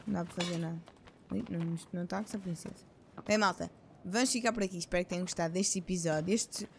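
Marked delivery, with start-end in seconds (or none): 0.0-2.9 quiet speech with hiccups; suppressing hiccups. Real, not acted. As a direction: talk softly through the hiccups. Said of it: "Tô com soluços".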